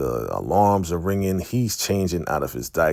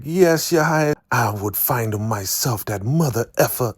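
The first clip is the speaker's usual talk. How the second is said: Elder Mother swearing to the Highest; cursing old woman; 7 semitones higher than usual. Retold or imitated. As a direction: spit the curse like a fiery elder. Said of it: "she is cursing her butt off".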